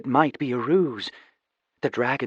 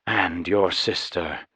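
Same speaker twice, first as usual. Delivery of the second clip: somber knight; 5 semitones lower, slower than usual. Acted.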